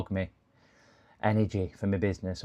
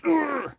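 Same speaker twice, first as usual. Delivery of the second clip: frustrated groan. Real, not acted. Frustrated at Google.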